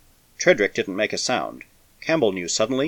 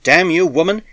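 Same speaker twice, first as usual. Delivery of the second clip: loud, higher curse; furious outburst at the stubborn woman; angry shout; 8 semitones higher, 5 dB louder than usual. A narrator's reading. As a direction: snap it at her in fury.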